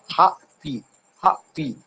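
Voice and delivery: "हा पी" split in two, weight on "हा"; teacher sounding out "हा पी" with the first part stressed; stress on first syllable. Shown as a demonstration. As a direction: break it into syllables, "हा" stressed, "पी" let go. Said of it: "इस पे हमें stress करना पड़ा".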